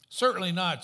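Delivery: a mock-stern priest saying no; priest refusing. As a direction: turn him down firmly as the priest. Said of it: "said the priest".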